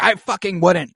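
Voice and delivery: queen snapping at Larys; mock snap. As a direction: shoot it back hard. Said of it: "and she's like".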